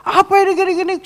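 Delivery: very high, loud angry yelling; hammed-up furious client, shrieked; imitated rampage. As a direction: explode in a high-pitched mock rage. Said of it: "Ngamuk dia".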